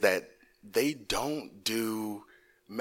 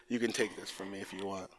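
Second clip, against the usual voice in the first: ordinary delivery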